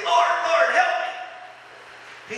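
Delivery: excited plea. Pretend. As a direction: call out to the Lord all worked up. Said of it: "'cause when you're all excited, say".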